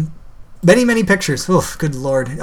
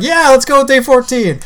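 higher, louder gung-ho agreement; mock enthusiasm; 8 semitones higher, 5 dB louder than usual. Mocking how officials picked a cutoff, mock-enthusiastic. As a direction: imitate someone eagerly jumping on an idea.

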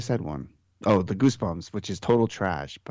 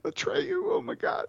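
mock tearful cry; 5 dB quieter than usual. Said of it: "I'm tearing up in the kitchen".

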